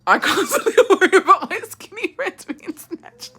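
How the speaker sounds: high laughing read-out; cracking up mid-sentence. Real, not acted. Laughing reading comment.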